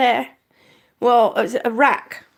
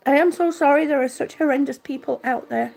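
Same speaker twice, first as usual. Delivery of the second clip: usual speaking voice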